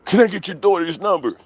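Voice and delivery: high mocking caller voice. Streamer retelling the troll's call, mocking voice. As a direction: pitch up to mimic the prank caller.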